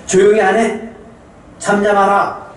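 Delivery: scolding crowd. Acted. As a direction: shut the beggar up sharply. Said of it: "다 비난의 소리".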